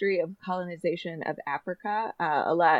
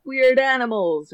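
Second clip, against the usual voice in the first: circus boss voice; 8 semitones higher, 5 dB louder, slower than usual. Retold or imitated. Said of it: "the guy is like".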